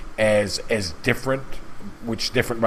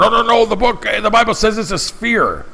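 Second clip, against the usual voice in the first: high, louder mocking whine; mocking impression; 10 semitones higher, 4 dB louder than usual. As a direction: mimic a whiny objector in a high voice. Mocking globe-believing Christians.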